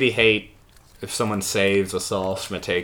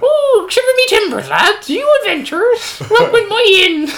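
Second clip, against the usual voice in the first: shrieky falsetto innkeeper, very high and loud; busty innkeeper; 9 dB louder than usual. Shown as a demonstration. Game master demonstrating a classic voice, busty innkeeper. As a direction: welcome adventurers in a hammed-up falsetto. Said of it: "busty innkeeper".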